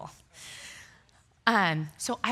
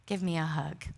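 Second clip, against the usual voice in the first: low, soft, tender invitation; gently coax, imitating a loving husband; hushed, lowered comforting voice; empathetic husband; 5 semitones lower, 8 dB quieter than usual. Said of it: "so empathetically is like".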